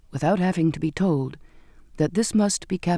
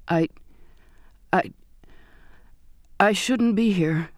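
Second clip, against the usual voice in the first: halting stammer. Acted. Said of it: "I managed".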